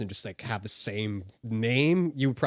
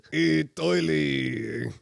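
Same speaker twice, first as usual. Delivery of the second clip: drawn-out, louder put-on voice greeting Toily; new puppet voice; 5 semitones higher, 7 dB louder, much slower than usual. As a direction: try out a goofy new character. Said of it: "I could talk like this".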